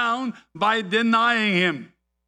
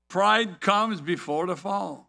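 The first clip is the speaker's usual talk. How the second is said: ordinary talk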